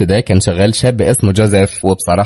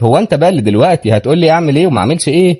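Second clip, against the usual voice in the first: fast, higher-pitched rude retort; 6 semitones higher, faster than usual. A narrator's reading. Rich guest snaps back at deckhand's advice, rude and fast. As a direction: challenge him with quick insolence. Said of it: "ردت بكل وقاحة شديدة".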